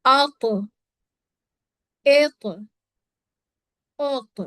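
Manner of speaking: आत, एत, ओत with the final त bounced back in an echo; qalqala echo. Shown as a demonstration. Teacher contrasting tva's echo with plain ta. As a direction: make the त of ओत rebound. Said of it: "त्वा को कैसे पढ़ेंगे".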